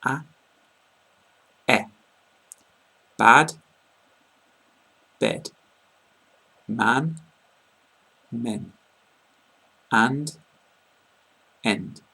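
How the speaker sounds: bad and bed, each word set apart; minimal pairs demo. Shown as a demonstration. Teacher repeating the word list, carefully. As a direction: model each vowel slowly and distinctly. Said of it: "The vowel is making the same sound".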